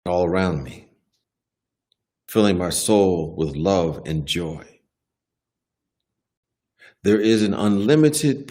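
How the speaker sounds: unhurried, spacious inspirational reading; devotional reading. Acted. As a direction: read it aloud as a reflective affirmation, line by line. Reads the daily devotional aloud.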